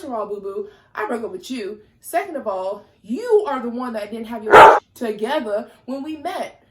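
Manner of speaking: loud, heated retort at her ex; getting heated. Real, not acted. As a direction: let it boil over into a loud rebuke. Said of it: "I'm getting a little heated".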